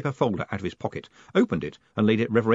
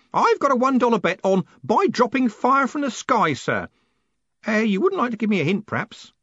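high-voiced old man, loud then quicker; old Grote, wheedling; 13 semitones higher, 5 dB louder than usual. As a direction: angle hopefully for a tip.

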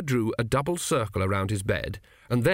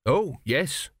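a touch hesitant; understated, unsure yes; 4 semitones higher than usual. A narrator's reading. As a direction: agree with slight uncertainty. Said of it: "said Tepic hesitantly".

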